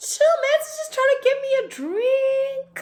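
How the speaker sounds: very high, airy brush-off; breezy dismissal. Retold or imitated. Brushing off worried friends, very high airy voice. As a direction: wave off the friends in a squeaky voice. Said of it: "And I'm like".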